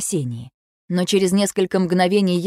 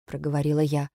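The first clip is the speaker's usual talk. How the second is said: usual speaking voice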